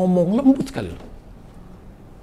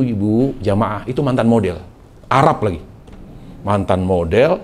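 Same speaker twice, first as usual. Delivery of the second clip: normal talking voice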